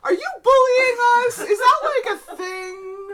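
laughing. Real, not acted. Player laughing at vampire copies.

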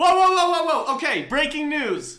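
loud, high whoa at the breaking news; startled by breaking news; surprised. Real, not acted.

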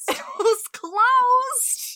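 high, slow laughing disbelief. Real, not acted. Laughing at the closed shop joke.

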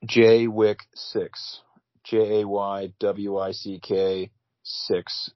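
slow, letter by letter; spelled out slowly. Real, not acted. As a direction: spell it out carefully for listeners. Spelling out his Venmo name.

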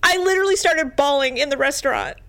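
talking through real tears; crying. Real, not acted.

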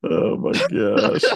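breathless slow laughing oh my gosh; can't get the words out for laughing; wheezing laugh. Real, not acted. Laughing hard at the friend's reaction, wheezing. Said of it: "I was laughing at that".

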